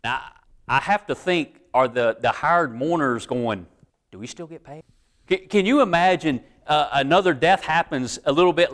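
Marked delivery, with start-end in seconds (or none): 4.1-4.8 worried aside. Retold or imitated. Mourners worried about payment.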